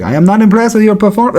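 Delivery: polite Canadian trash talk, lightly imitated. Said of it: "the most Canadian trash talk".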